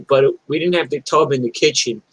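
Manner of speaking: ordinary delivery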